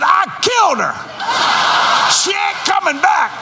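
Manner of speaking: high, loud mock-angry wife; husband imitates his wife shouting her comeback, pitched high; wife's mock fury. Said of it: "Kathy said".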